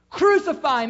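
high, loud mob yell. Retold or imitated. Preacher voicing the mob before Pilate, loud demanding yell. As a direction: shout it back like the angry mob. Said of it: "each time they got louder".

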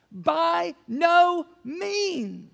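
By no means, slow, high and loud; emphatic. Retold or imitated. Preacher thunders Paul's answer to the church, slow and loud. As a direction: drag out By no means with full force. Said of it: "he's emphatic with this".

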